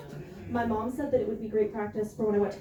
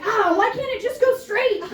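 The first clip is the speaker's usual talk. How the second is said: higher, louder mock whine; frustrated mom; 8 semitones higher, 5 dB louder than usual. Mom frustrated at roundabouts, mock whining. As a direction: whine about the road like a fed-up mom. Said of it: "She just gets frustrated and she's like".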